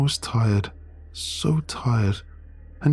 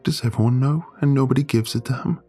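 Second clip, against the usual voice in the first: high, tearful voice near breaking; words catching in a tight throat; choking up; 7 semitones higher than usual. Acted. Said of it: "She chokes out her words".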